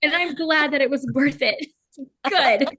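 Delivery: proud emphasis. Real, not acted. Proudly answering tearful fans.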